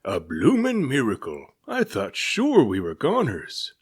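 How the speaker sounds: relieved captain shouting to the sky; gruff sea captain. A narrator's reading. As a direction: bellow it like an old sailor. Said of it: "cried the captain".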